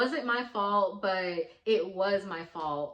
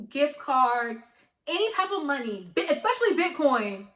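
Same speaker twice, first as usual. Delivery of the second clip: emphatic warning; 5 semitones higher, 5 dB louder than usual. Real, not acted. Warning viewers at story's end.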